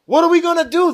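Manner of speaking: pitched high and loud in alarm; panicked priests asking each other; alarmed question. A narrator's reading. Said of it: "they asked each other".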